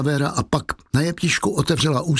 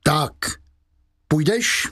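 calling out; 4 semitones higher, slower than usual. Acted. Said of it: "a zvolal".

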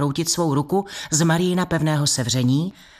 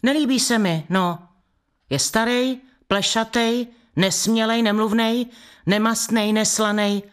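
higher, listing faults one by one; higher complaining list; 6 semitones higher than usual. A narrator's reading. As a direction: whine out each fault in turn. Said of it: "complaining girl". (vyšším hlasem vyjmenovává vady)

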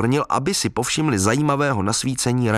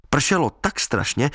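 plain reading voice

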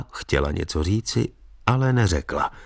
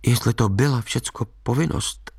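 higher, tentative defiance in character; regaining courage; 5 semitones higher than usual. A narrator's reading. Maid questions his official duty, regaining courage. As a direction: challenge gently as nerve returns. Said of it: "sbírajíc znovu svou odvahu".

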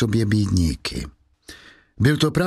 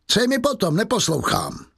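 scornful dismissal; 8 semitones higher than usual. Acted. Javert dismissing.

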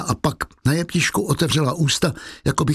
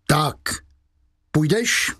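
higher-pitched curt call; 4 semitones higher, slower than usual. A narrator's reading. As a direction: call it out as a demand, without real volume.

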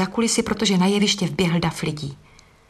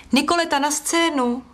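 calling out; 7 semitones higher than usual. Acted. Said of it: "zvolal ze scény".

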